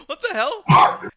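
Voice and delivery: high, loud, slow what the hell; startled. Retold or imitated. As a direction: bark it out in disbelief. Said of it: "I'm like".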